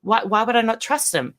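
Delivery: normal talking voice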